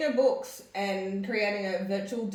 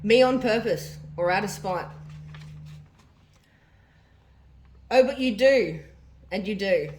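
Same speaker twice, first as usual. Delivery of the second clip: diary reading; 4 dB louder than usual. Acted. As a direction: read the diary entry out with feeling. Reads mum's diary aloud.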